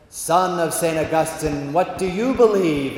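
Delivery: slow, grand intoned question; God. Acted. God questions the Augustinian, slow and majestic. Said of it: "that's how he talks".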